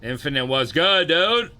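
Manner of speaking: loud hello climbing high at the end; big rising shout-out; hollered greeting. Real, not acted. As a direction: call out warmly to a buddy joining in. Calls out greeting to arriving viewer.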